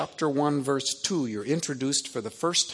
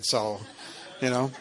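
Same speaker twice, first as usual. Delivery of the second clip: usual speaking voice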